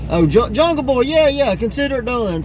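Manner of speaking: high, eager yes-man voice, pitched well up; mishearing Young Buck. Retold or imitated. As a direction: chirp it out like a clueless helper happy to oblige. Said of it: "all Young Buck heard was".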